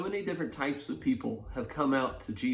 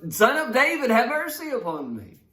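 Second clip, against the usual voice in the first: much higher, louder desperate shout; louder cry; 10 semitones higher, 6 dB louder than usual. Retold or imitated. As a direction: yell it over everyone who hushes you. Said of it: "that only made him louder".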